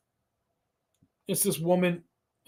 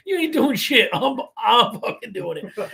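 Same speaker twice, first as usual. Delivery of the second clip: defiant; 7 semitones higher than usual. Retold or imitated. Defiant player quoted, laughing.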